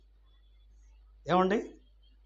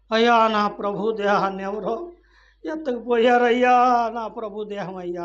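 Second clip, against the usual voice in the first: raised, stretched mock lament; imitating a tearful wail; imitated weeping plea; 5 semitones higher, 7 dB louder than usual. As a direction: plead as the grieving woman, mildly acted. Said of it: "ఏడుస్తుంది".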